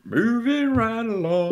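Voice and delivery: high, slow Kermit the Frog croon. Retold or imitated. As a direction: sing it like the frog puppet. Muppet song quote, Kermit.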